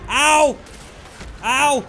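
high loud ouch cries; mock pain yells. Gamer yelping loud and high as his character takes hits. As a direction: yelp as if hit. Taking damage in game.

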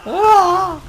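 very high, loud shriek of fright; terrified shriek at an ambush. Real, not acted. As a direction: let out a piercing scream. Sudden scare in game.

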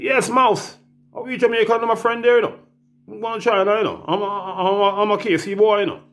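high, bright mimicking of his own call; mock high voice. To his friend Mouse on the phone, high mock voice. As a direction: squeak it out like a buddy on the phone.

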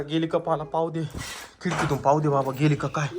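low, soft checking voice; hushed, cautious. Acted. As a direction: keep it down while peeking out. Father cautiously checks witch has gone.

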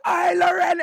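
loud, high call to Lorente; excited shout of his name. Retold or imitated. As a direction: hail him across the street. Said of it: "I was like".

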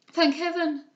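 loud, high exclamation of thanks; relieved cry. A narrator's reading. Henrietta sees the patient wake, crying out. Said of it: "cried Henrietta".

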